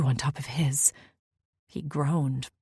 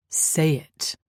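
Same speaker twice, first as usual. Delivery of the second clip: demanding; 4 dB louder than usual. Acted. Lover demanding she give in, firm command. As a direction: insist she say the word. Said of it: "he demanded".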